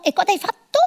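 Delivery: gentle mommy sing-song; baby talk. Acted. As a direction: ask it like praising a little child. Baby talk to toddler continues.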